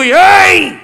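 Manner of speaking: sharp high yell; one loud angry shout; crowd yelling hey. Retold or imitated. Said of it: "అని అరుస్తుంటే".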